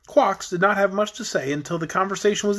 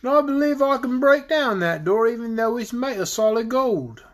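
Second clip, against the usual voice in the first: raised-pitch, put-on dragon voice; dragon character; 5 semitones higher than usual. A narrator's reading. Dragon boasting he can break the door, higher character voice.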